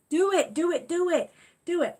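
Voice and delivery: high, loud eager chant; bouncy repeated push; excited urging. Real, not acted. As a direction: cheer them on to do it. Urging viewer to set up Alexa.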